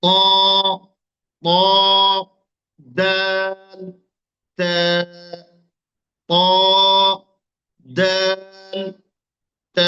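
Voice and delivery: "ক", "দাল", "তা" sustained on a level note; articulation drill. Shown as a demonstration. Teacher modelling three letters from one place, long drawn-out drill. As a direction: draw out "ক", "দাল", "তা" one at a time from the tongue tip. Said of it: "এই তিনটা অক্ষর উচ্চারণ করুন".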